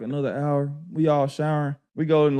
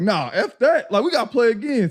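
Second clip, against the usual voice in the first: higher-pitched mock protest; retold indignation; 9 semitones higher than usual. Beaten starters demanding to play again, mildly mad and pitched up. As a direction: object like an annoyed losing player. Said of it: "so I'm mad now".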